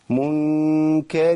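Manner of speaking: منكر with a long, slow nasal hum; nasal ghunna demo. Shown as a demonstration. Teacher telling students to listen to the ghunna. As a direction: hold the ghunna on منكر before the kaf. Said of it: "اسمعوا كده".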